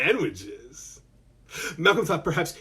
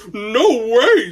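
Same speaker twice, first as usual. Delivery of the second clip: alarmed refusal; 6 semitones higher, 6 dB louder than usual. Acted. Cooper refusing, about to hide.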